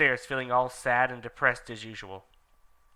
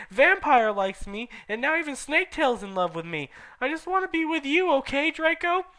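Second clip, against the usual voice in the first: put-on whiny teen; 12 semitones higher, 4 dB louder than usual.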